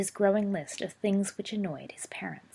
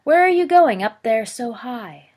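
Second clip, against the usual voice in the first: much louder, higher, yelled rhyme; loud boy's sing-song; 5 semitones higher, 11 dB louder than usual. A narrator's reading. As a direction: belt it out to annoy the parents. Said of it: "he could do loud very well".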